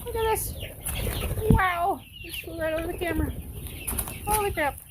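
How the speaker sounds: high, excited amazement; amazed. Real, not acted. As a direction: marvel at the flying chicks. Chicks flying out amazed.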